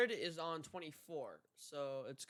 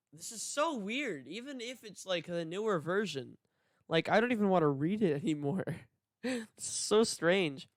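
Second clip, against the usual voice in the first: exasperated; 4 semitones higher, 9 dB louder than usual. Real, not acted. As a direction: break off reading, fed up and half laughing. Reader exasperated by archaic text.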